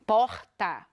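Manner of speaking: "Porta" with a strong throaty R, "poxta"; rolled mineiro R. Shown as a demonstration. Teacher showing students the mineiro R in "Porta". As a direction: lean on the R in "Porta" the Minas way. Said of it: "a gente escuta esse R".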